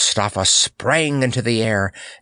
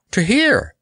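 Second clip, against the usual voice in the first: uneasy; 12 semitones higher, 4 dB louder than usual. Acted. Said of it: "gazing about him uneasily".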